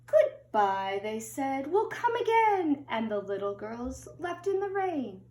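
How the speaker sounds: storybook read-aloud, gentle and lilting; children's picture book narration. A narrator's reading. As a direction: read it like bedtime story time.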